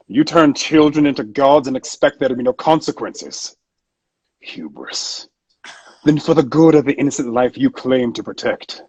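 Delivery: lofty villain passing judgement; the Arbiter. Acted. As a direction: speak as one above it all. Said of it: "it's my favorite thing to, like, sound".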